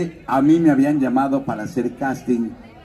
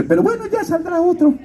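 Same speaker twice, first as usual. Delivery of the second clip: high put-on godmother voice. Godmother telling her godson his character dies, in a high put-on voice. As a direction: break the news in a high-pitched caricature.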